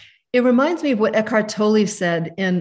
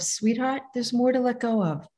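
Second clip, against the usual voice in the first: soft and tender, softer voice; gentle soothing self-talk; loving mother; 3 semitones higher, 4 dB quieter than usual. Shown as a demonstration. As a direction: speak to yourself like a loving mother. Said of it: "Like a loving mother, I say to myself".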